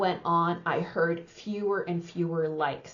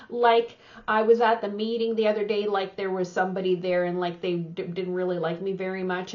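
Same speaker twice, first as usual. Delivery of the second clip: quick, rambling run full of likes; filler-heavy sample speech; faster than usual. Shown as a demonstration. Coach imitating overuse of like for listeners, quick rambling chatter. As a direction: rattle it off like a chatty, like-peppered anecdote. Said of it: "you start the sentence off like".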